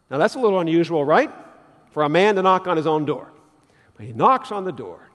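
normal talking voice

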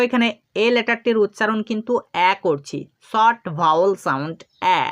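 ordinary talk

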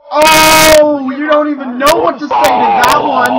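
loud, high gloating jeer; loud taunt. Real, not acted. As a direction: crow over the comeback. Taunting a trash-talker.

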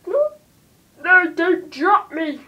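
put-on high, loud, slow British-accented plea. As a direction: plead nervously in a British accent. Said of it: "like, a British accent?".

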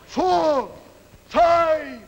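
high, loud drawn-out count with falling tone; referee's count. Acted. Referee counting over a downed fighter, loud ringing count. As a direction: call it out like a ring referee. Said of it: "the referee is counting over him".

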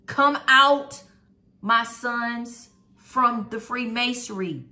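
fiery preacher calling out; preaching. Acted. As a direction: preach it with full force. Preaching a call to come out.

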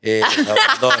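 high, laughing abandono; joking dad walking out, laughing; laughing joke. Pretend. Jokingly quoting father leaving.

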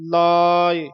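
ਲਾਏ as long "laa" with light short "i"; lengthened "aa". Shown as a demonstration. Teacher demonstrating sihari, long vowel, released ending. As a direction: stretch ਲਾਏ, let go on a brief "i". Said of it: "ਈੜੀ ਤੇ ਆਵਾਜ਼ ਛੱਡ ਦੇਣੀ ਹੈ".

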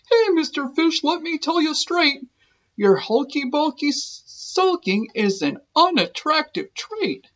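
octopus pitched high, telling it straight; high sing-song scold to the pout fish. Acted. Octopus scolds the gloomy fish in a high voice.

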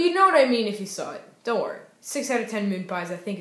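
normal talking voice

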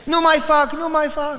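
put-on high-pitched begging of a punished boy; mock pleading. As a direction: imitate a child promising to stop. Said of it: "mi amigo hacía esto".

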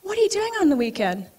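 high, quick friend-on-the-phone voice; fast, very high imitated chatter; Janet. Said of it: "phone call from my friend Janet".

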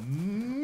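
long rising "moo" cry; farmer's cattle call. Shown as a demonstration. Player showing the family's cry to move cows, cattle call. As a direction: draw out a high cattle-herding call, held back. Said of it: "They used to go".

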